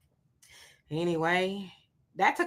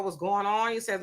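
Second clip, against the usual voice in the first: ordinary talk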